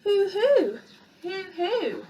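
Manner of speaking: high mock owl hoot; child mouse copying the ghostly hooting; imitating an owl. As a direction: copy the owl's hoo. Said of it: "It keeps calling".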